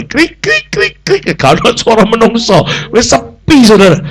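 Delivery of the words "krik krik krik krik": very high chirping imitation; squeaky little cricket noises; cricket chirps. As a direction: chirp like crickets in a silent village. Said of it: "suara jangkrik".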